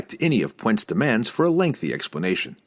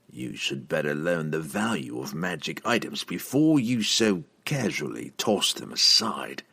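softer, measured villain lecturing; disdainful assassin; 3 semitones lower, 4 dB quieter than usual. Acted. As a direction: school your rival with cool disdain. Narrator voices the assassin.

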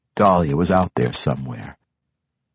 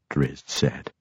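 plain reading voice

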